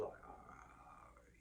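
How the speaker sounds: breathy hushed whisper. Retold or imitated. Guard quietly telling inmates the destination, in a whisper. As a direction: lean in and let it out under your breath. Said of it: "He's like".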